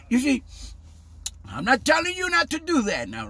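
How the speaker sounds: ordinary delivery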